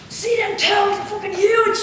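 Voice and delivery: a hint of a thick Irish lad, loud and eager; Irish lad impression. Irish lad at the campsite bragging to her, in an Irish accent. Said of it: "He's so Irish".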